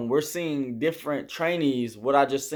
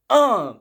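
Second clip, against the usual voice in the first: effortful grunt; 9 semitones higher, 7 dB louder than usual. Retold or imitated. Straining harder, told to push further.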